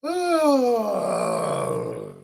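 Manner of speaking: long high crying sigh; a pained, almost sobbing sigh. Real, not acted. Upset by abuse testimony.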